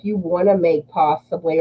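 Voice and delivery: ordinary delivery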